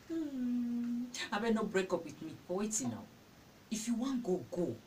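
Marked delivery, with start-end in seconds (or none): 0.0-2.3 gasping, whimpering fake cry; hammed-up tearful plea; mock sob. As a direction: pretend to sob and beg. Mocking a crying girlfriend.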